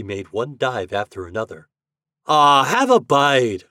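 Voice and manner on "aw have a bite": loud, drawn-out, raised call; teasing cry. A narrator's reading. Reddy heckles Herbie at the apple game, crying out. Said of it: "cried Reddy".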